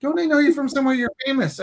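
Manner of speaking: acted-out excited recognition; insistent stranger. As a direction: press the question like a starstruck lady. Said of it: "She goes".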